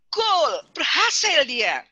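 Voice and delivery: slow, loud "goal" cheer; a slow cheer of success; excited cheer. Acted. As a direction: celebrate like a goal was scored. Baby finds the breast, cheering.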